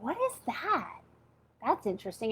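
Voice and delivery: whispered wonder, softly; a soft curious murmur to herself; hushed aside. Retold or imitated. Retold self-talk finding book.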